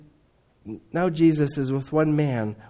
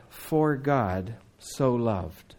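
much slower, lower, every word weighted; slow and solemn; 5 semitones lower, 4 dB quieter, much slower than usual. Acted. Jesus tenderly telling Nicodemus of God's love, slow. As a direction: let each word land slowly.

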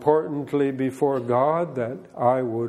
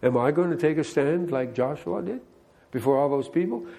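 ordinary talk, nothing marked

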